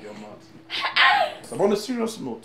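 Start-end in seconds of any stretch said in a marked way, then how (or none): 0.0-1.4 high mock squeal. Mocking teasing reply.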